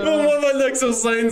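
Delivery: shouted announcement. Real, not acted. Repeats line, second shouted.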